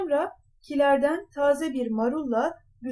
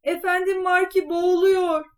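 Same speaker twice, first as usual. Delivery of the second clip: loud, raised-pitch cry of alarm; shouting alarm; 6 semitones higher, 6 dB louder than usual. A narrator's reading. Cat telling the king his master is drowning, shouting. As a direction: call it out so the whole carriage hears. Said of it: "diye bağırmış".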